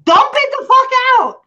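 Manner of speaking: high exasperated yell; shrill-free high shout of frustration. Retold or imitated. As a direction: yell it at him, fed up. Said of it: "I'm like".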